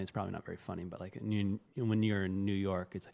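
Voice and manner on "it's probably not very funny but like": ordinary delivery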